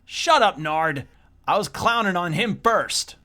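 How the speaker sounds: put-on bratty teen yelling at his friend; shouted teen squabble. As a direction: shout down your buddy.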